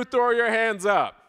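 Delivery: normal talking voice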